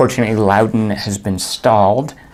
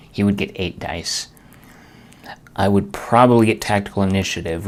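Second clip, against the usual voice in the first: usual speaking voice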